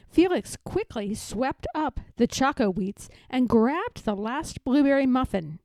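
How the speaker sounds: plain reading voice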